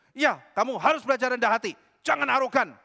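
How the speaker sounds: plus aigu et plus fort, donneur de leçons; sermon condescendant. Retold or imitated. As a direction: faire la morale de haut. Pria sombong menasihati.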